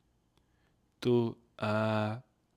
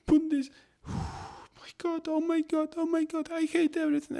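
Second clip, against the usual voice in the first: high, loud, breaking-point squeal; meltdown rant; 6 dB louder than usual. Retold or imitated. Worn-out hotel worker wailing about the rooms, high and loud. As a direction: shriek out the exhausted worker's despair. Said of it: "I'm like".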